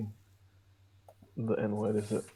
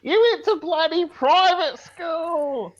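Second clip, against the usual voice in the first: much higher, louder and slow, drawn out; mock Aussie taunt; 5 dB louder, slower than usual. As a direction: bellow the taunt in a hammed-up Aussie voice.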